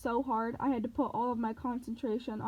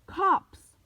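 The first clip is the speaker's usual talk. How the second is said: high alarmed shout; 8 semitones higher, 9 dB louder than usual. Acted. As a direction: warn with a loud cry. Annabeth warning.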